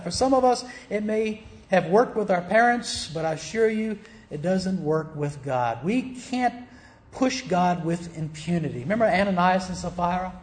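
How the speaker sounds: usual speaking voice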